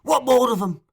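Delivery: high, loud demand; urgent cry. A narrator's reading.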